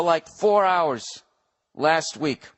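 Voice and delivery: ordinary talk, nothing marked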